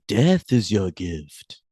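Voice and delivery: slow, drawn-out spooky pronouncement. Retold or imitated. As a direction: intone it slowly like a puppet vampire. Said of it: "sort of like the Count from Sesame Street".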